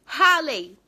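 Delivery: "Raleigh" said as "HA-lei"; Brazilian-style "Raleigh", read as HA-lei; mispronounced Raleigh. Retold or imitated. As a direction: say "Raleigh" with an H and open A. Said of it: "não é assim que pronuncia".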